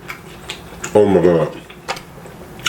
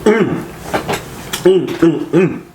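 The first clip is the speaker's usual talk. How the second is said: delighted mm; 10 semitones higher, slower than usual. Real, not acted. Tasting food, delight.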